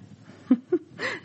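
high, playful laugh; mother's playful laugh about the groom; teasing laugh. Acted. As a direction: laugh slyly, teasing her daughter.

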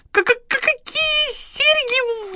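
very high, slow stutter; thin, stammering terror; terrified babble. A narrator's reading. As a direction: squeak in horror, stuck on the first sound. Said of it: "в ужасе пролепетала девушка".

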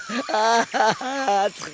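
bitter cackle. Acted. Cornelius laughs in his rant.

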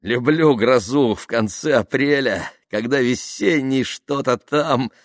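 high, slow, playful declaiming; amused reciting. A narrator's reading. Tamara reciting verse as they drive into rain, amused. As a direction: recite a half-forgotten poem merrily. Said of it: "засмеялась Тамара".

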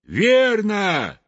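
high, drawn-out cheer of approval; rousing high exclamation; crowd shout. A narrator's reading. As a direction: cry out for the whole crowd. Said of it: "крикнули все хором".

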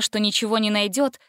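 usual speaking voice

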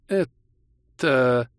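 scared, husky little bleat; hoarse bleat. A narrator's reading. Hero bleating hoarsely at the looming grim. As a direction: squeeze it out hoarsely, like a sheep. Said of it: "сипло проблеял я".

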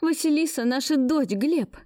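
high, urgent blurt. Acted. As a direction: blurt it out in a rush. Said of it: "выпалила я".

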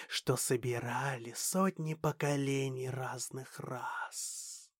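soft whispered alien elder; slow alien whisper. Acted. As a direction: hush it out slowly, gravely. Alien elder hands over knowledge.

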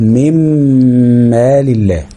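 من مال الله as "mimmaal", heavy hummed m; doubled meem. Shown as a demonstration. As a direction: hold a long m from من into مال الله. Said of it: "فبقت ميم مشددة".

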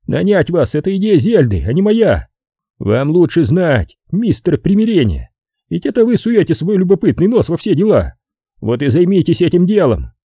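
high-pitched, put-upon man; high, testy character voice; irritated Brogan. Acted.

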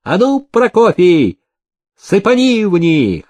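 high, excited cry; rousing shout to a nephew; shouted order. A narrator's reading. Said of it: "Никита крикнул".

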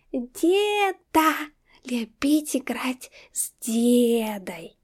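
put-on a happy small child chattering; toddler voice. A narrator's reading. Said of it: "картаво приговаривала".

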